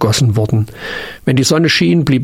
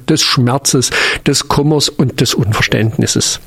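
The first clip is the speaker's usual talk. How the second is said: plain reading voice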